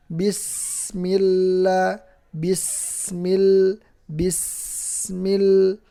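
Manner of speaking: bismil chanted slowly with sin and lam held; slow tajwid demo. Shown as a demonstration. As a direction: stretch each letter of bismil. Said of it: "nggak boleh sama". (bismil dibaca pelan, huruf ditahan)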